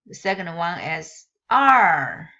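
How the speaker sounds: Mandarin er with rising tone; the second tone er, slow and rising; tone demo. Shown as a demonstration. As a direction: say er rising like a question. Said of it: "Notice that tone mark".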